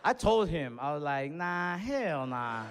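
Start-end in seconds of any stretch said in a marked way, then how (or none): 1.3-2.6 slow mock disbelief. Disbelieving the big guy.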